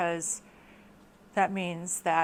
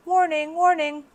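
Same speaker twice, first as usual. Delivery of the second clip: mock alarm; 8 semitones higher, 8 dB louder than usual. Alarm at red flag.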